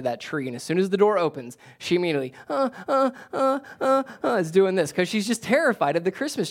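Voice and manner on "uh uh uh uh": put-on high fussing uh uh of a scared baby; imitating a frightened baby; baby fussing imitation. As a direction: mimic a startled infant's whimper, much higher.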